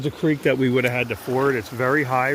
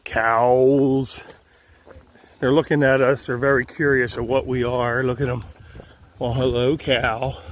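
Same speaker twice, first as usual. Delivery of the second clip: normal talking voice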